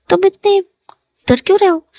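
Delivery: high-pitched, playful challenge; teasing question. Acted. Lover teasing his nervous partner about being scared, high teasing question. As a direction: lift the voice high to tease.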